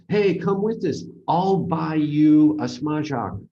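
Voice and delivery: loud, high and eager come along; eager invitation. Retold or imitated. Voicing an inviting offer.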